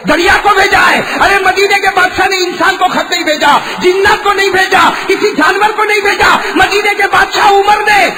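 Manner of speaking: high, loud sermon shout; impassioned preacher at a high pitch; full-force preaching. Acted. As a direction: thunder it out to the crowd.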